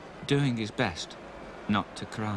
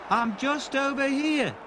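mild shout; 14 semitones higher than usual. Acted. Stanley calling to Stopper Wilkins, mild mock shout. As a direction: call across the pitch lightly. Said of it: "shouted Stanley".

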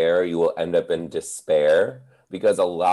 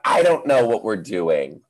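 own reply; 3 semitones higher, 3 dB louder than usual. Retold or imitated. Teacher answering the kids in own helpless voice. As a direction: own voice, slightly raised. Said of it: "I'm like".

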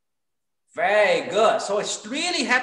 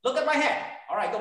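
usual speaking voice

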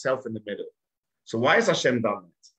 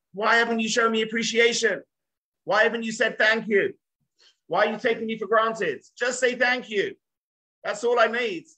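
high, pleading wife's inner voice; voicing the wife; 12 semitones higher than usual. Wife asking husband for appreciation, high pleading voice. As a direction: speak as the wife asking to be thanked. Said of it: "She means".

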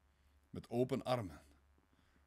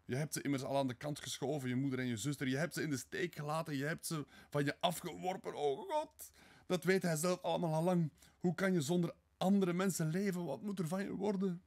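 high, agitated outpouring, swelling louder; distressed pleading; 8 semitones higher, 5 dB louder than usual. Acted. Sonja despairs over his future, distressed pleading. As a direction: pour out despair at what he has done.

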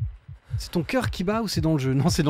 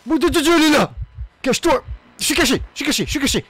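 panicked shouting; 7 semitones higher, 6 dB louder, faster than usual. Real, not acted. Monster arrives, hiding in panic.

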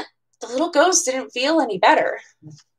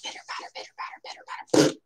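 very soft voiceless "pitter, patter"; whispered rain sound; 9 dB quieter, faster than usual. Shown as a demonstration. Showing viewers the rain sound, faint whisper. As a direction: breathe the rain words barely audible.

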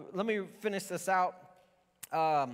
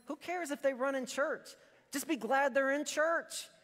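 mock retort; 9 semitones higher than usual. Pastor voicing his own retort.

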